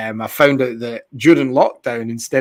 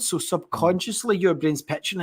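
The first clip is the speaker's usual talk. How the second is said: ordinary talk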